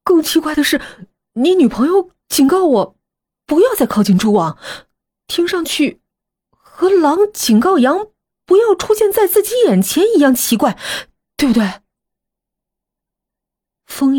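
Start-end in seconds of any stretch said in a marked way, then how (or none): 0.0-11.8 high, animated questioning voice; puzzled young rival. Acted. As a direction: press the puzzle in a raised, pointed voice. (音调高扬、疑惑地追问)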